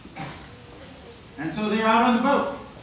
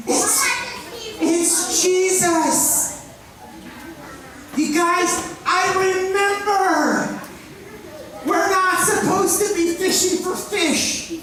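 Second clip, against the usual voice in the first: excited Peter; 8 semitones higher, slower than usual. Retold or imitated. Peter thrilled to recognize Jesus, high excited shout. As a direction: burst out with joy at the realization.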